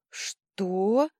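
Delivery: loud, rising shout of disbelief; amazed cry. A narrator's reading. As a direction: cry out in sheer astonishment. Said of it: "полный изумления вскрик мэтра Жужжина". (изумлённый вскрик)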